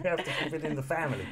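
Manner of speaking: talking through laughter; laughing. Real, not acted. Host joking with co-host about rumors, laughing. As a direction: get it out quickly while laughing.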